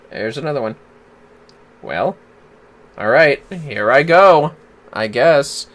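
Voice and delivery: ordinary delivery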